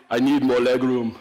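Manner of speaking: put-on comic Russian accent. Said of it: "in a Russian accent".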